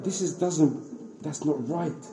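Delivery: hushed, hesitant disbelief; quiet doubt. Retold or imitated. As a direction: mull it over under your voice. Umar privately doubting what he heard.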